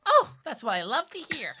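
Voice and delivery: loud, high imp voice; high, gleeful creature squeal; Diplin delighted. Acted. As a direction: exclaim happily as a high-voiced little imp. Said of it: "And he goes on pushing it".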